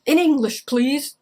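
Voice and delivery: loud, firm mock demand; tough soldier. Quoting the soldier cliche to writers, firm and loud. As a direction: snap it like a movie soldier. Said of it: "No one is going to read".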